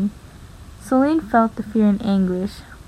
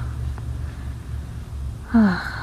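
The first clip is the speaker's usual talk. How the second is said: heavy voiceless sigh of giving in; long weary sigh; 8 dB quieter than usual. Acted. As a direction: let out a long tired breath. Weary father gives in.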